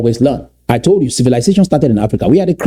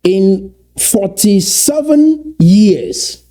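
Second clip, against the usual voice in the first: forty-seven drawn out slowly and pitched high; slow emphasis; 8 semitones higher, much slower than usual. Real, not acted. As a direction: lean hard on "forty-seven years," stretching every word. Dramatic pause after big claim.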